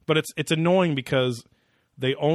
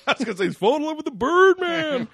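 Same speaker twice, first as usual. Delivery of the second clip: laughing; 11 semitones higher, 5 dB louder than usual. Real, not acted. Joking, laughing, high voices.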